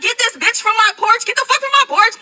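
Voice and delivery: high-pitched retold angry order; indignant outburst. Woman throws friend and man off porch, indignant. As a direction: order them off in a high, outraged voice.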